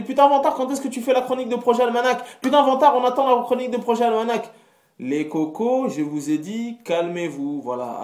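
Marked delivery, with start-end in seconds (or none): 0.0-4.5 pestering fan voice. Retold or imitated. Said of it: "le nombre de personnes qui m'ont dit".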